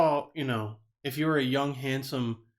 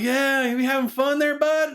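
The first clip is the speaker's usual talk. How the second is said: tag along bystander; 10 semitones higher, 4 dB louder than usual. Retold or imitated. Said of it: "And the people were like".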